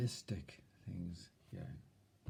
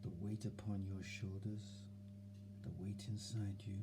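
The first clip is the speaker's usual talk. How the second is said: softer, hushed, half-whispered calm; soft soothing voice; 6 dB quieter than usual. Shown as a demonstration. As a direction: soothe yourself like a child. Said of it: "See that tone of voice?".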